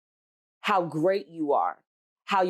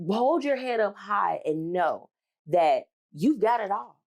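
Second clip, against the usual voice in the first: ordinary talk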